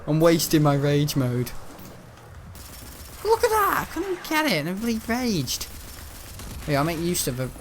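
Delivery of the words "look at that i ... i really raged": high excited squeal at the game; excited shriek. Real, not acted. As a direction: yelp it out, thrilled and outraged.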